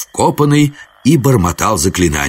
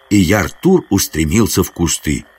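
plain reading voice

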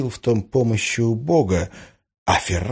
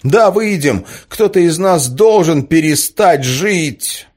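higher and louder, angry through tears; angry crying; 6 semitones higher, 4 dB louder than usual. Acted. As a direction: hurl it back, sobbing with rage. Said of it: "рыдая".

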